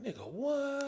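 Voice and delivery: high, hushed, incredulous question; stunned disbelief. Retold or imitated. Actor reacting to the director's jab, stunned and hushed. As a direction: let it out small and high, in disbelief.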